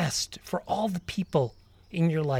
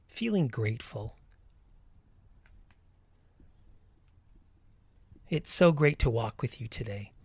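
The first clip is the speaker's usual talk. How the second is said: guided meditation. Acted. Leads a walking meditation.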